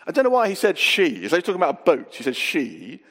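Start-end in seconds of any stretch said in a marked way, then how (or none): none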